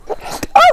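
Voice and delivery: sudden sharp sneeze; a real sneeze breaking through; sneezing. Real, not acted. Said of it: "Oh, sorry, I'm gonna sneeze".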